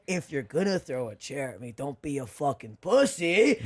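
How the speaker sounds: slow, deliberate taunt; taunting dare. Retold or imitated. Comic daring the chair-throwing heckler, slow taunting dare. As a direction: dare him, slowly.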